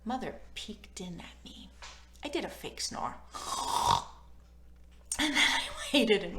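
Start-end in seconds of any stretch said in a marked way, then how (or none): none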